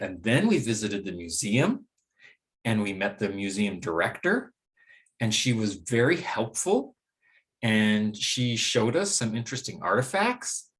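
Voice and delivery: each phrase ends rising on "museum", "director", "helpful", "artifacts"; rising intonation. Shown as a demonstration. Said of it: "I kept using the rising intonation pattern".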